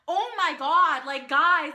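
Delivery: loud, high starstruck exclamation; freaking out. Retold or imitated. Fan telling clueless friend and cousins, acted freak-out. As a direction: exclaim it, acting out total disbelief. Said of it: "I was freaking out".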